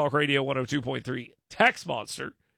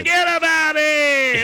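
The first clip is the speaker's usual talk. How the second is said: mock Italian-American; 9 semitones higher, 7 dB louder, slower than usual. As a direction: holler it like a cartoon Italian New Yorker. Mock Italian-American protest, hammed up.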